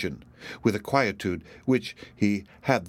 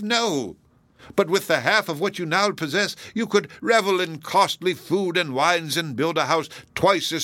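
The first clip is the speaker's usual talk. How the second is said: mournful, lightly acted reproach; a touch of anguished appeal; pleading Hepzibah; 6 semitones higher, 3 dB louder than usual. A narrator's reading. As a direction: plead earnestly with a cousin, building in intensity. Hepzibah pleads with her cousin.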